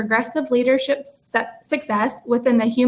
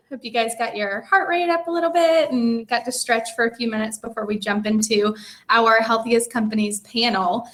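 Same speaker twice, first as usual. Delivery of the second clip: normal talking voice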